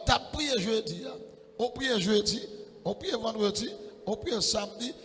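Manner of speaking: ordinary talk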